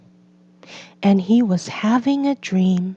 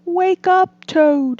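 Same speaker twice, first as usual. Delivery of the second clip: high, louder wake-up call; Frog waking friend; 9 semitones higher, 4 dB louder than usual. A narrator's reading. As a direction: rouse Toad, higher and louder.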